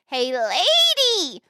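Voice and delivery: shrill squawked shout, drawn out long; squawking parrot. Acted. Parrot heckling a passing lady, squawking. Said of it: "and the parrot says".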